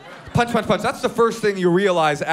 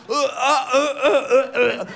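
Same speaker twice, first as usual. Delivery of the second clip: slow strangled uh sounds; mock choking noises; choking gurgle; 6 semitones higher than usual. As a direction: imitate a man gurgling in a chokehold. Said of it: "gurgling and choking".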